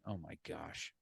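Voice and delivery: very quiet, low-pitched retold "oh my gosh"; sinking dismay. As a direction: murmur it low, as if shrinking into the seat. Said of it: "sinking lower in my seat going".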